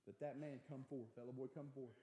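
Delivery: ordinary talk, nothing marked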